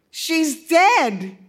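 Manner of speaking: put-on high, loud upset young boy; a boy wailing with dismay; distraught boy. A narrator's reading.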